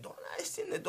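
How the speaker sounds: breathy hushed echo of どないしてんねん; whisper. Acted. Guard hushing his friend, repeating どないしてんねん in a whisper. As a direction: mutter どないしてんねん under your breath. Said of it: "お前、そんな怒って".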